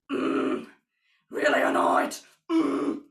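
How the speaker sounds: loud growling metal grunt demo. Shown as a demonstration. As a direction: push out a loud rough grunt. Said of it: "If it's the grunt you are looking for".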